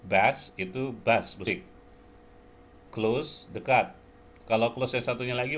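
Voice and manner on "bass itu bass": Bass said slowly, louder; bass as in music. Shown as a demonstration. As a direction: stretch the vowel of bass.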